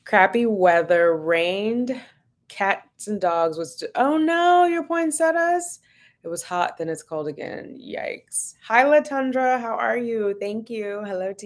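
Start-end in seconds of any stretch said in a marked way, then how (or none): 4.0-5.8 much higher, drawn-out oh no; a hint of mock alarm; mock dismay. Real, not acted. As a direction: gasp at the poor poinsettias. Reacting to viewer's dead plants.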